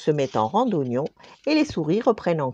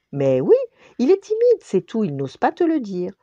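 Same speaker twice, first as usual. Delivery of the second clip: higher, louder encouraging voice; accordion reassuring; 6 semitones higher, 4 dB louder than usual. Acted. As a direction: convince her, eager. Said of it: "dit l'accordéon".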